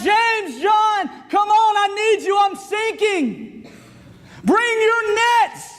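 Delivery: high, stretched-out calls for help; urgent calling out. Acted. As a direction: cry out to far-off mates, drawing out the last words. Peter calling for help while sinking.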